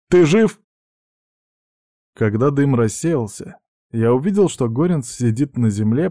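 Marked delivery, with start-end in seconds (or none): 0.1-0.6 loud, high worried question; shout. Acted. Friend to the man after the shot, worried shout. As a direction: shout it in alarm. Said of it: "крикнул я".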